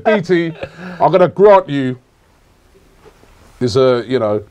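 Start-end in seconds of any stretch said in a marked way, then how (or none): 0.0-2.0 raised, louder mock God; half-laughing, hammed-up divine announcement; playful God voice. Host restarting his God act for co-host, amused and raised. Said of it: "God talks in a Jamaican accent".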